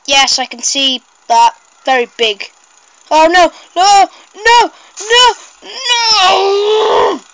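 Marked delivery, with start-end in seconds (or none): 3.1-7.2 each no higher and louder, ending in a cry; rising shouts of no. Real, not acted. Watching the lawnmower man fall, rising desperate shouts. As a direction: panic building into a desperate cry. Character falling, despair.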